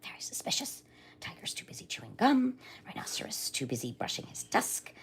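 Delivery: whispered suspicious grumble to himself; muttering whisper. A narrator's reading. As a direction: hush it into a secret mutter. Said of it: "muttering to himself".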